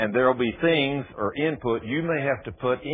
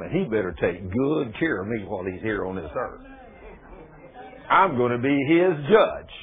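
mild preaching; 5 semitones higher, 6 dB louder than usual. Acted. Retold lecture about his angel.

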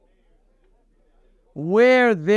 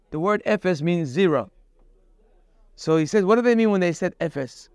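usual speaking voice

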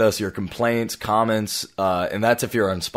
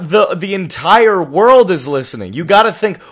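higher and louder, scolding; exasperated scolding; 8 semitones higher, 7 dB louder than usual. Real, not acted. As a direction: lecture him in alarm. Scolding co-host about audience.

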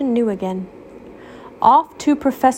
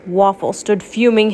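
ordinary delivery, nothing marked

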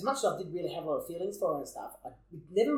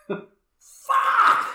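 hammed-up despairing shout, much higher and louder; anguished yell; 13 dB louder than usual. Telling his mate she said yes, a hammed-up anguished shout. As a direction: wail it like it's the end of the world. She confirms he friend-zoned her.

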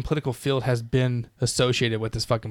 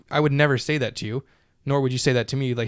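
ordinary talk

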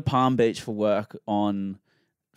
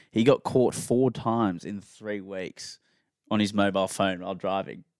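ordinary talk

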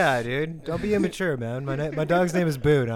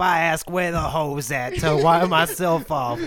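slow, loud swaggering comic; Scat Williams impression; 6 dB louder than usual. Riffing as the comic in France, slow mock swagger. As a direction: draw it out as a bragging stand-up.

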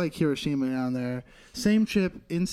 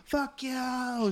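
homeless lady cursing; 9 semitones higher, much slower than usual. Retold or imitated. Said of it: "She's like".

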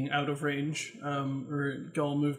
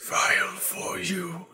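creepy whisper; 3 semitones higher than usual. Acted. Creepy NPC offers vial.

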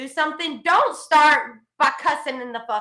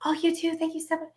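higher, softer sugary farewell; mock sweet caller; 4 semitones higher, 7 dB quieter than usual. Caller returns the agent's good wishes, mock-sweet imitation. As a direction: put on a caller's sudden politeness.

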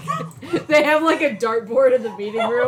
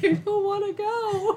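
higher, half-laughing whimper; a fake tearful plea that cracks into laughter; mock whine; 7 semitones higher, 5 dB quieter than usual. Voicing Mark begging not to go.

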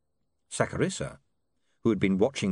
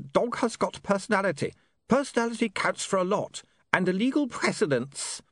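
higher-pitched smooth villain voice; pitched-up replayed speech; recorded villain; 11 semitones higher than usual. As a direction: play back the villain's speech through an imp.

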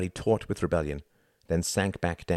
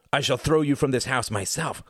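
snarled threat; 8 semitones higher, 3 dB louder than usual. Acted. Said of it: "snarled Quentin".

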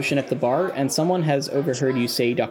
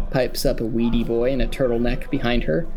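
normal talking voice

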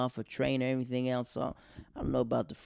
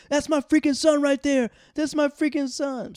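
high, loud, hammed-up proud yell; proud dad cheering; 8 dB louder than usual. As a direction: crow it like a dad bragging to strangers. Dad cheering son on the field.

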